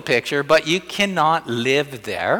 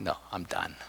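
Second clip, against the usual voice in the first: weary giving up; 6 semitones lower, 16 dB quieter than usual. Retold or imitated. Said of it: "I'm like".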